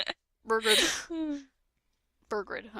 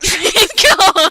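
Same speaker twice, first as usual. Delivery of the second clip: exasperated cry; 6 semitones higher, 11 dB louder than usual. Real, not acted. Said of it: "I keep doing it".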